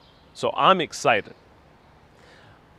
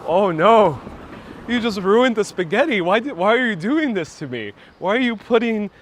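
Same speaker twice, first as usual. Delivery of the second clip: higher, half-playful horrified inner voice; mock outrage over spaghetti; mock dismay; 5 semitones higher than usual. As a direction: play up the kid's horror at the ruined dish. Retold dismay at yogurt.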